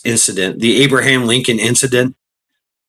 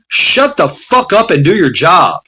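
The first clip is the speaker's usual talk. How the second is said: angry retort; 4 semitones higher than usual. Retold or imitated. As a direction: snap back at whiners, fired up. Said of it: "I'm on the other end going".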